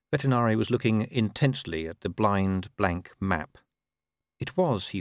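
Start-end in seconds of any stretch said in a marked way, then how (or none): none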